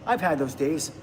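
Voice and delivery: ordinary talk, nothing marked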